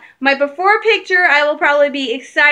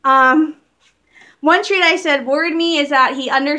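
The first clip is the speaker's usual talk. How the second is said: ordinary delivery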